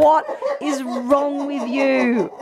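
slow, laughing disbelief; friend's slow, laughing what-is-wrong-with-you; incredulous friend. Retold or imitated. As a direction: drag it out while cracking up. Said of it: "My friend has just looked at me like".

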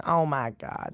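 drawn-out Southern mom impression. Son showing the hosts how his mom sounds, Southern accent. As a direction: imitate mom's slow Southern accent. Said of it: "she sounds like this, and she's, like, all Southern".

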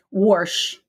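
wash with an added R, "worsh"; Kansas City "wash". Retold or imitated. Imitating her dad from Kansas City, wash as "worsh". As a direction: put an R in wash. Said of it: "He pronounced".

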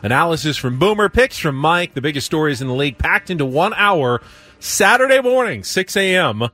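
upbeat promo announcer read. Acted. Radio host reads the weekly show promo, upbeat announcer.

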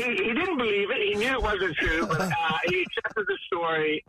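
ordinary delivery

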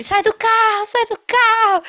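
high-pitched mock shout, urgently repeated; imitated yelling. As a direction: act out an examiner yelling someone out. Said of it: "o cara começou a gritar comigo".

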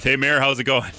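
ordinary delivery

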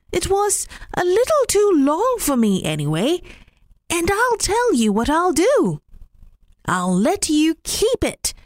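high, friendly rabbit bargaining; generous rabbit voice. Acted. Rabbit proposing a trade to Mistress Puss, high and friendly. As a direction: offer the trade kindly, pitched high.

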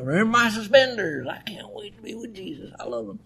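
put-on high, soft voice of a fond old teacher; old professor quoted. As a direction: lift it up high and hush it, dreamy old man. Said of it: "he'd say the same thing".

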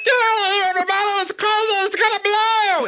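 squeaky very high helium-voice yell. Pretend. Helium worker warning everyone to run, squeaky helium voice. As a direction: squeal it like you just inhaled helium.

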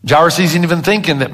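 usual speaking voice

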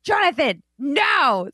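sharp high mock scold of a child; mom scolding. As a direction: call the kid off like a fed-up mom. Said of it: "their mom being like".

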